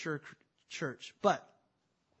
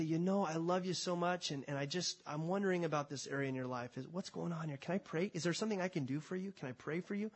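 hushed, tender concern; gentle hushed plea to a friend; soft and caring; 7 dB quieter than usual. Acted. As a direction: speak it softly to a sister in the Lord. Gentle confrontation of a sister.

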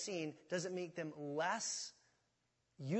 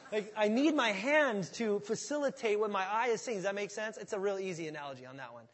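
ordinary talk

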